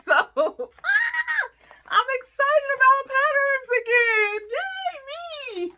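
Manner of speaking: very high, loud laughing squeal; very high, loud laughing cry of delight; excited squeal. Real, not acted. Overjoyed about patterns.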